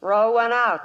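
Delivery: loud call across the room. Acted. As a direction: call it out over the noise. Calling for music in saloon.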